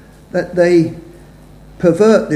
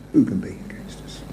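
whisper; 8 semitones lower, 10 dB quieter than usual. Shown as a demonstration. Preacher echoes the verse to the congregation in a whisper. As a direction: echo the question in a hushed whisper. Repeats scripture question, whispered echo.

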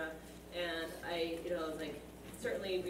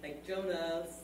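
ordinary delivery

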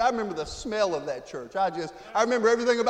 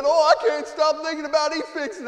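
raised, higher retelling of a tearful call; mildly emotional, lifting voice high; retold crying; 8 semitones higher, 7 dB louder than usual. Brother calling the preacher in tears, mock tearful. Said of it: "He was crying".

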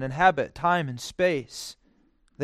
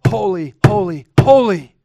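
building louder and higher on each holy; louder, climbing holy, holy, holy; emphatic; 4 semitones higher, 12 dB louder than usual. Shown as a demonstration. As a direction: swell into a ringing proclamation. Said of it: "no way to be more emphatic".

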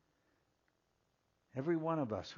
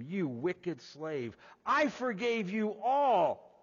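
slow, higher, louder rebuke of the wicked slave; stern rebuke; 8 semitones higher, 5 dB louder, slower than usual. Acted. Lord rebuking the unforgiving slave, slow, higher, louder. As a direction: scold him slowly and heavily. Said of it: "his lord said to him".

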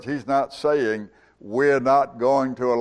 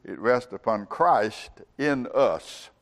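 usual speaking voice

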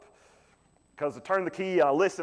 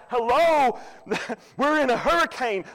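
mock panicked disciples; 7 semitones higher, 3 dB louder than usual. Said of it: "And they're like".

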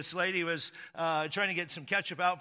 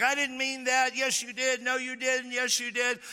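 mock quarrel; 7 semitones higher than usual. Acted quarrel between friends.

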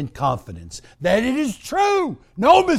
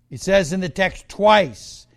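ordinary delivery

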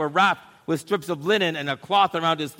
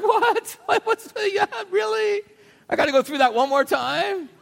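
very high, laughing mock outrage; laughing disbelief; 3 dB louder than usual. Lazarus told he must die again, laughing high disbelief. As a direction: squeal it through a laugh, incredulous.

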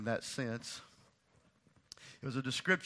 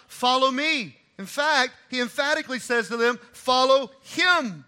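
loud, high, building sermon; full-voice pulpit emphasis; rising preaching; 9 semitones higher, 10 dB louder than usual. Real, not acted. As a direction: preach it out with force.

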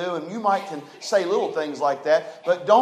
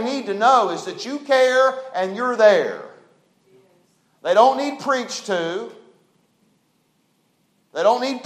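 preaching at full force, louder and higher; 6 semitones higher, 8 dB louder than usual. Acted. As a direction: rise into the sermon, pressing each line. Preacher raises voice.

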